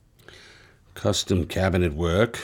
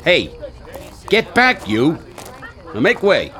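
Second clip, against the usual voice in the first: shouting at the crowd; 7 semitones higher than usual. Acted. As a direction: clear the crowd from the crime scene. Sergeant pushing back crowd.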